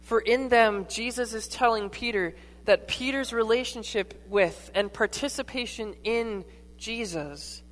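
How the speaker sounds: ordinary delivery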